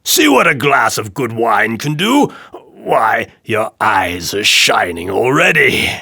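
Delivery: booming, mocking tyrant; drunken king crowing over his joke; loud jeering king. A narrator's reading. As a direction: taunt the dwarf loudly. King voiced in story.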